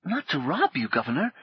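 put-on a small boy put out at the suggestion; aggrieved boy. Potboy protesting to Paul, mildly aggrieved. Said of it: "He sounded aggrieved".

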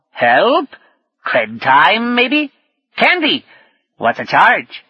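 high, drawn-out swooping creature voice; lizard's kid speak. Acted. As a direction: squawk garbled kid slang as a little lizard. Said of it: "so sunk in kid speak".

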